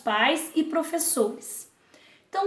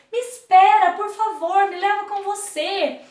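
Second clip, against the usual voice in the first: higher, louder plea to wait; calling out; 6 semitones higher, 5 dB louder than usual. Acted. Love calling after Vanity's boat, pleading loudly. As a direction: shout after the leaving boat. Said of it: "tentou ir embora o amor".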